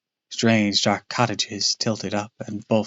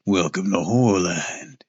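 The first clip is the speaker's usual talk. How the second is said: gruff low voice; 3 semitones lower than usual. Acted. Costumed monster greeting guests, gruff and low. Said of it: "in a gruff, low voice".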